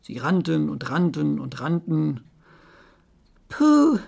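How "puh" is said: high, loud puffed-out "phew"; pig's loud groan; groan of relief. Acted. Pig after running away, groaning loudly. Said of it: "stöhnte das Schwein".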